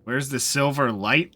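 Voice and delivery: ordinary talk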